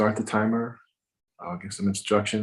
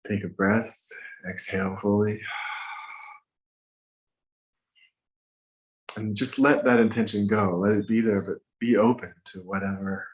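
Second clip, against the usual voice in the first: guided meditation. Acted. Meditation teacher guiding listeners to set an intention, gently. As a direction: ease listeners into setting an intention.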